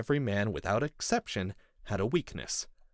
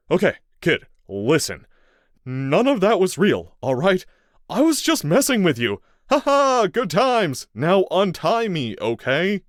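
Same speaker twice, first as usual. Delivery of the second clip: nervous placating; 11 semitones higher, 12 dB louder than usual. A narrator's reading. Captive man nervously placating.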